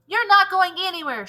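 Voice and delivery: shout. Acted. Todd shouts to stop the teacher, defiant. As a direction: cut her off with a defiant yell. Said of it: "shouted Todd".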